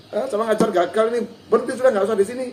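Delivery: high, loud outburst of giving up; acted exasperation. Acted teacher giving up on pupil. (kesal pura-pura, suara tinggi dan keras)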